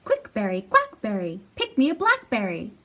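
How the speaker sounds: lively sing-song read-aloud; reading to children. Acted. Teacher reading Jamberry aloud to young kids. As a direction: bounce the rhymes for little listeners. Reads a picture book aloud.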